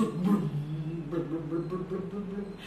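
low burbles fading away; bubbling sound. Acted. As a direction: imitate sinking into thick stew. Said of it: "and it goes".

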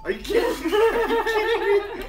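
high, loud laughing disbelief; shocked outcry. Real, not acted. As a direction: cry out in stunned disbelief. Shocked at losing the round.

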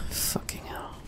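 whispered curse under breath; whispering. Real, not acted. Frustrated muttered curse.

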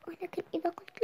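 hushed secret whisper; whispering the pot's secret. Acted. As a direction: keep the secret of the tree. Secret of the magic pot.

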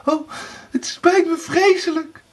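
very high, tearful apology; sobbing. A narrator's reading. Erika begs Blauwbaard's forgiveness, sobbing. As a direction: wail the apology as the wife. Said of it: "snikte Erika".

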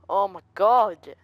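loud gasp and drawn-out exclamation; startled. Real, not acted. Player jump scared while searching for objects, startled. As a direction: jump out of your skin.

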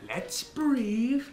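put-on Cockney greeting; British accent. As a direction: greet them in mock Cockney. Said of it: "You're British?".

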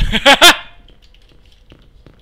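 korkea, kova naurunpurskahdus; kova nauru. Real, not acted. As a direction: purskahda nauruun. Nauraa kaverille. (kova korkea nauru)

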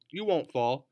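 ordinary delivery

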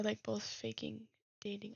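ordinary delivery

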